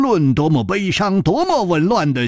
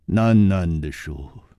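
ordinary delivery, nothing marked

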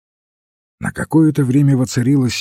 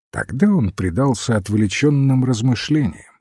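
usual speaking voice

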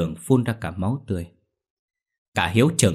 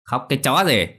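snarling; 6 semitones higher, 4 dB louder than usual. Acted. Mother sneers at weeping parents.

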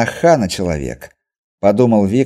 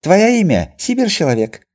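Chinese merchant voice; 10 semitones higher, 3 dB louder than usual. A narrator's reading. Merchant voice in dialogue.

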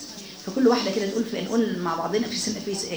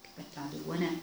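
prayer; 6 semitones lower, 11 dB quieter than usual. Acted. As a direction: lead the closing prayer gently and devoutly. Leads closing dua. (دعاء بصوت خافت وخاشع)

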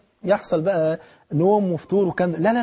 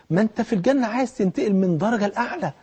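normal talking voice

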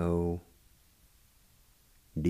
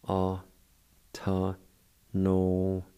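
ordinary talk, nothing marked

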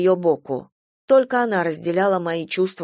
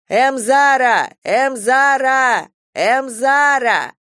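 long, high, loud drawn-out call; 5 semitones higher, 6 dB louder, much slower than usual. A narrator's reading. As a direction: call across the fence, stretching the name. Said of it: "надрывался парень". (парень громко зовёт через забор, протяжно тянет имя)

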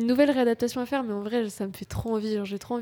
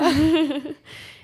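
a bright, loud burst of laughter; sudden real laugh, higher and louder; 5 semitones higher, 7 dB louder than usual. Real, not acted.

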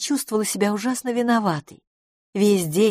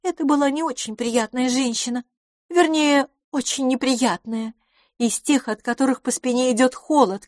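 markedly higher character voice; candid, affectionate lady friend; 7 semitones higher than usual. Acted. Lady confides fondness to her new friend, affectionate. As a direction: hold the lady's high pitch throughout.

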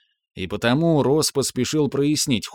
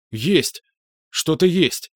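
excited find; 6 semitones higher, slower than usual. Acted. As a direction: pitch jumps up with excitement. Liam finds the drive.

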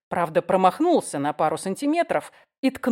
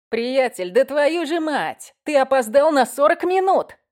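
angry yell; 7 semitones higher, 6 dB louder than usual. Acted. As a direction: yell at him for being late. Friend yelling at latecomer.

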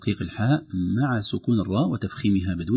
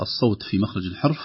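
ordinary talk

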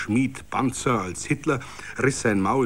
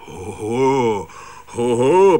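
swelling loud murmuring protest; rising defiant hoho; 8 dB louder, much slower than usual. A narrator's reading. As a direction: grumble up into defiance. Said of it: "brach es dann aus den Bauern".

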